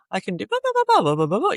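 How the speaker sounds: put-on baby-like babble jumping in pitch; playful babbling. Babies playing with their voice, babbling up and down. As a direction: play with the sound of each blah. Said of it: "babies play with their voice".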